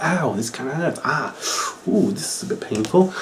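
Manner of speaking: soft mock whimper. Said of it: "he's like".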